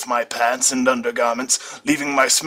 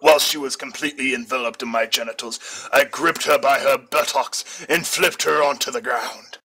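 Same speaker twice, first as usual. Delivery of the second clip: plain reading voice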